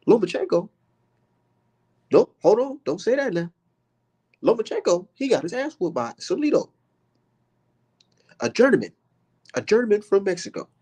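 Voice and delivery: crank the pitch up and ham the elder; Roy Jones impersonation. Retold or imitated. Streamer redoing his Roy Jones impression, very high and hammed. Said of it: "That's my Roy Jones impersonation".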